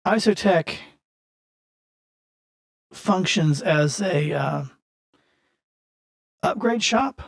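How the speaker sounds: put-on smooth-talking boss, mildly accented; crime boss NPC.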